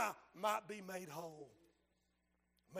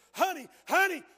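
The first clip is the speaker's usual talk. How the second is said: anguished cry; 7 semitones higher, 9 dB louder than usual. Retold or imitated. Mary cries over dying man.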